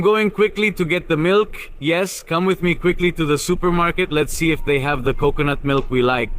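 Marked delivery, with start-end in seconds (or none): none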